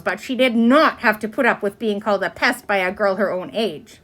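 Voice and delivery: ordinary delivery, nothing marked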